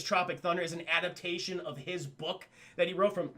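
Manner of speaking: normal talking voice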